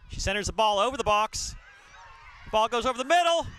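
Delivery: rising to a high, loud yell; building excited shout. Real, not acted. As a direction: build to a scream as she shoots. Last-minute shot, live commentary.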